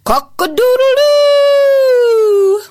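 long loud high crow; rooster crow. Acted. Rooster calling his hens with a long loud crow. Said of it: "he called out to his hens".

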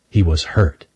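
plain reading voice

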